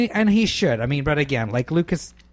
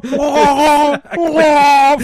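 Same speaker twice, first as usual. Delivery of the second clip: Wookiee roar; 13 semitones higher, 10 dB louder than usual. Retold or imitated. Wookiees talking to each other.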